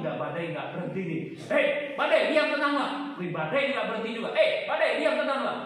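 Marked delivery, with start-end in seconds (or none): 1.5-2.9 high, loud, repeated yell at the storm; mock yelling at a stubborn storm, loud and higher; mock shouting. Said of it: "Ada diulang-ulang?". 4.3-5.6 high, loud, repeated yell at the storm; mock yelling at a stubborn storm, loud and higher; mock shouting. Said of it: "Ada diulang-ulang?".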